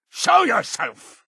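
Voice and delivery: high, carrying demand; calling out. Acted. Ordering the hidden child to come out, high call. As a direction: call out to someone in hiding.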